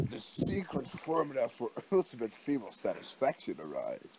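ordinary talk, nothing marked

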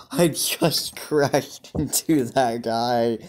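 slow, breaking into laughs mid-line; words broken by real laughter; laughing through words. Real, not acted. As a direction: giggle while confessing the crash. Laughs telling of the crash.

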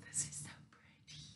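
breathy little whisper, quick. Real, not acted. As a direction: hush it like a secret delight. Whispered aside in unboxing.

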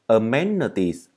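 "Amenities" with a short uh in the middle; slow "Amenities", middle vowel a neutral uh; teacher demonstrates "Amenities" with schwa. Shown as a demonstration. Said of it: "Đây là âm ơ".

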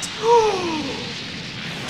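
sharp gasp. Real, not acted. Anticipates dramatic finish.

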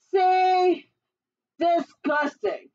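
high, loud, drawn-out disgust; angry giant. A narrator's reading. Giant dumping beans on his head, angry. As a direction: exclaim it in big disgust. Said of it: "he was still angry".

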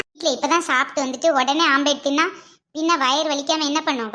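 usual speaking voice

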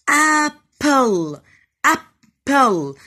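"apple" broken as AP - PLE, both beats loud; teacher sounding out "apple" in two slow beats; split into two syllables. Shown as a demonstration. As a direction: chop "apple" in the middle, loud and drawn out. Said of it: "بصوا، بقطع الكلمة في النص".

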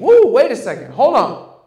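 high, loud "Woo" to halt everything; loud, startled interjection; mock astonishment. Real, not acted. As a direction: stop the room in surprise. Stops in surprise at the verse.

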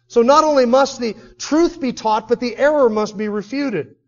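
fiery sermon delivery; preaching at full force. Acted. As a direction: preach it hard and high. Preacher builds to climax.

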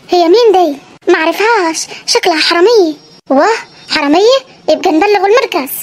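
put-on a wary old villager; old Saidi grandfather. Grandfather asking about the stranger, low and suspicious.

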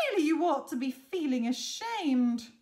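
loud, indignant telling-off; scolding Betty. A narrator's reading. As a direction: scold loudly as an outraged scarecrow. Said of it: "Betty exclaimed".

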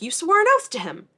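indignant shout at Celaena. Retold or imitated. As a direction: throw the oath back at her. Heated argument, Chaol voiced.

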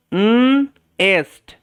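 "উম এস্ট" as long nnn, gap, then est; sounding out. Shown as a demonstration. Teacher modelling nest for learners, sounded out slowly. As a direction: slowly split nest after a held n. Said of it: "pronounce করার জন্য".

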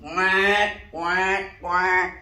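loud duck quacking; loud quacks. Acted. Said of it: "and he went".

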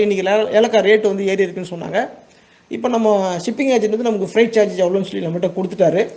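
normal talking voice

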